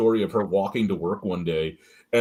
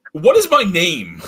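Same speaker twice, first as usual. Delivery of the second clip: indignant, louder demand; 7 semitones higher, 8 dB louder, slower than usual. Retold or imitated. Confronting coworker about name.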